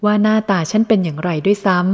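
plain reading voice

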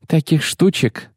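snorting feigned indifference, high; sneering fake sweet. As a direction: brush it off with a scornful snort. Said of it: "фыркнула она, снова изображая безразличие". (насмешливое напускное безразличие)

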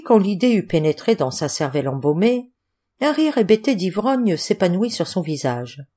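ordinary delivery, nothing marked

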